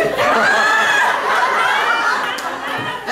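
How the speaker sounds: long rising laugh; high cackling laugh. Real, not acted. Man cackling at his own dog food meatloaf.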